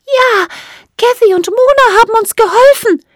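plucky little fairy, very high voice; high-pitched, chin-out fairy defiance; Emma Erdbeerrot. A narrator's reading. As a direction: declare it defiantly, high and loud. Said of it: "erklärte Emma Erdbeerrot und reckte das Kinn vor".

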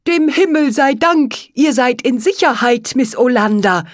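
relieved frog, stretching the words. A narrator's reading. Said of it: "seufzte er erleichtert".

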